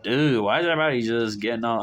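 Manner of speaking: normal talking voice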